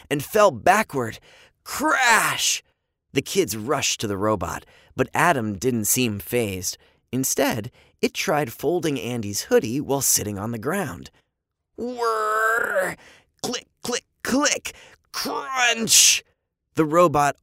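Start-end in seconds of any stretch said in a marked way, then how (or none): 1.6-2.6 voiced crash sound. A narrator's reading. 11.8-16.2 voiced machine noises. A narrator's reading.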